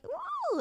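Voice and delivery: tiny high-pitched ooh; high cooing ooh. Acted. Streamer acts out the nails' cuteness, squeaky ooh. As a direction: coo softly, very high. Imitated reaction about the nails.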